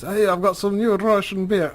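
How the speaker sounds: high, hearty mock Russian accent; jovial Ukrainian father. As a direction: press drinks on guests in a put-on Russian accent. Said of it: "quite a jovial chap".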